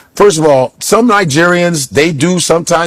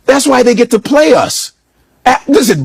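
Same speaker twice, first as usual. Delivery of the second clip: ordinary delivery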